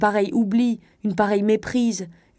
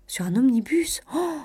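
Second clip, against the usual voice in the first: amazed echo; 6 semitones higher than usual. A narrator's reading. Cousin hearing of a husband lost on a bus, amazed. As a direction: echo it in disbelief and exclaim.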